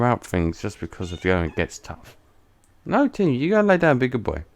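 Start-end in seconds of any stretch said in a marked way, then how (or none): 2.9-4.4 higher coaxing pet-talk; talking to the cat. Real, not acted. Sending Tim the cat to bed, lightly higher coaxing voice. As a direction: coax the cat to lie down.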